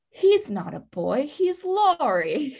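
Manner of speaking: slow, swooning Jo, rising high at the end; lightly voiced dreamy Jo, drawn out; a hint of starry-eyed Jo; quoting Winona Ryder. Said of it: "the line that Winona Ryder delivers is".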